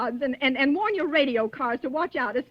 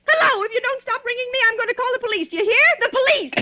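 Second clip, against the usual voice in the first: high, loud, frantic shrieks; hysterical panic; 10 semitones higher, 4 dB louder than usual. Acted. Terrified invalid threatening a silent caller, shrieking high and loud. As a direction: scream the threat in terror.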